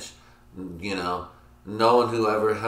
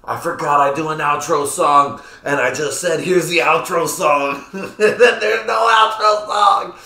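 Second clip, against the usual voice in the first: much higher, louder, slowed, exasperated yell; frustrated shout; 8 semitones higher, 8 dB louder than usual. Retold or imitated. A vlogger cursing himself for forgetting the outro, loud exasperated yell. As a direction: blow up at yourself for forgetting, laughing at the end. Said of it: "I was like".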